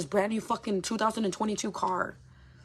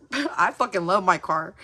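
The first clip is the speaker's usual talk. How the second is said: real laugh spilling into louder words; laughing gush; 8 dB louder than usual. Real, not acted. Telling viewers about her new car, laughing louder. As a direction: laugh it out, delighted with your car.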